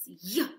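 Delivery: a sharp "ya" like a karate chop. Shown as a demonstration. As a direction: bark out the ya. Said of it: "So Y says".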